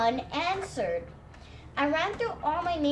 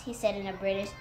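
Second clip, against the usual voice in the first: ordinary delivery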